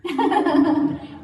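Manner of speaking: high bright laugh; real laugh at being asked about marrying Richard; burst of laughter. Real, not acted. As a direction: laugh out loud at the question. Laughing at marriage question.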